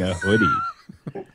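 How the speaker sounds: laughing. Real, not acted. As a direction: break into laughter. Cracks up reading card.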